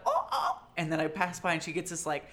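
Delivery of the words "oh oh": high squeal; squeaky excited oh. Retold or imitated. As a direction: squeak it out in delight. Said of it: "she's like".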